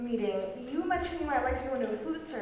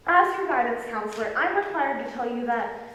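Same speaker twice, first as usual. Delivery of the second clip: ordinary talk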